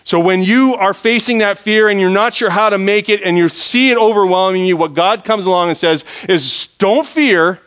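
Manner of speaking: high, loud, impassioned sermon delivery; full-force preaching. Real, not acted.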